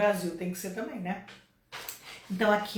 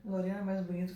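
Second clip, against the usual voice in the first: laughing at herself; 4 semitones lower, 7 dB quieter, much faster than usual. Real, not acted. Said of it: "forcei um pouquinho".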